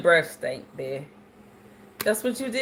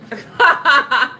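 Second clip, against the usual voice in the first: high, loud laugh into the words; 10 semitones higher, 8 dB louder than usual. Real, not acted. Host laughing after telling the breath story.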